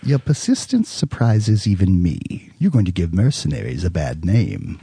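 lightly voiced film villain, measured and deliberate; Belloc. Guest asked to do a film quote, attempted villain voice. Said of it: "then do Belloc anyway".

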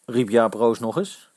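ordinary delivery, nothing marked

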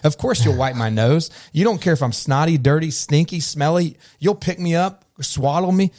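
put-on high, self-assured child voice; confident child. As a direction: play a toddler sure everyone adores them. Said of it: "my children who'd come into a room and be like".

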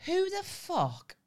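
can't believe what you just heard; stunned disbelief. Real, not acted. Friend shocked the washer came with the house, high incredulous squeal.

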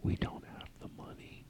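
breathy hushed whisper. Acted. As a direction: whisper it behind the guest's back. Secret aside off-air.

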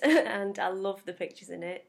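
ordinary delivery

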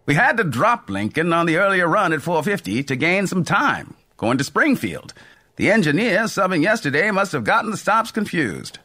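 bright, breezy railroad conductor voice; a jovial conductor shrugging it off; cheerful old conductor. A narrator's reading. Conductor explains schedule.

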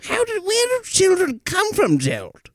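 high, put-on creepy squeal; creepy character. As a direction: whine it like a disgusted creep.

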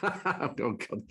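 laugh, then groan out the words through it. Real, not acted. Laughs at the worst-gig question.

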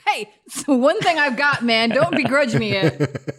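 laughing, mock offended protest; mock indignant. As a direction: play hurt, laughing.

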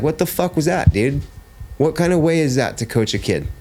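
high, indignant retold challenge; aggressive confrontation. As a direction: call out the coach in outrage. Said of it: "I was a little bit more aggressive".

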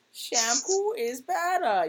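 high, slow goofy movie-line voice; lightly voiced Billy Madison line, drawn out; Billy Madison quote. Fan quoting a favorite movie line, in a high, slow silly voice. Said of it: "I do the".

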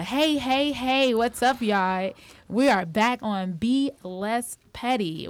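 loud, high, energetic host greeting; hyped podcast intro. Real, not acted. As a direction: pump up the audience.

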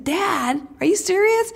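high-pitched, disbelieving question to Dad; incredulous kid. Retold or imitated. Kid questioning Dad about paying for the racket, incredulous. Child's astonished retort to dad.

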